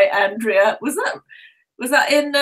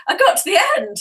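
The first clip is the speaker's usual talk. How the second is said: ordinary talk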